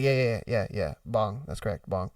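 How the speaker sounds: very low, hushed confirmation; embarrassed customer. Acted. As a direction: shrink into a low, sheepish murmur.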